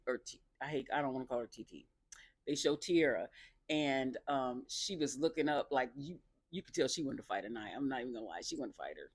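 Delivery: ordinary talk, nothing marked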